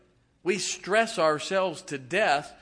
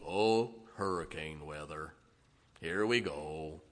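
much lower, softer, slow glum donkey moan; Eeyore; 11 semitones lower, 8 dB quieter, slower than usual. Retold or imitated. Preacher mocks weather worriers as gloomy Eeyore, low slow moan. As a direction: sigh it out like a hopeless donkey. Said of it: "I could be like Eeyore".

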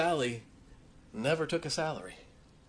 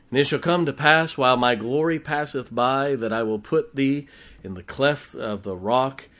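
louder, measured King James reading; scripture reading; 5 dB louder than usual. Acted. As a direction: proclaim the verses to the congregation. Reads scripture aloud.

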